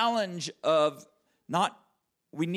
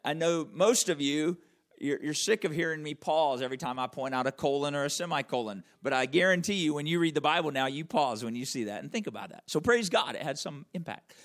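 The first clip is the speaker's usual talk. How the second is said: ordinary delivery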